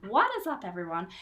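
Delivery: mock American YouTuber greeting; a light American accent on the catchphrase. Host mocking American YouTubers, lightly voiced American accent. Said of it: "they say it in an American accent".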